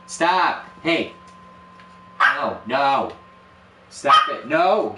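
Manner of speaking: high, loud commands to stop; loud, sharp scolding of a pet; scolding the dog. Real, not acted. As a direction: tell the dog off loudly.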